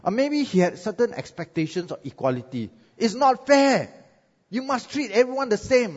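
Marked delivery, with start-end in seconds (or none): 3.0-6.0 indignant protest. Acted. Cain protests unequal treatment.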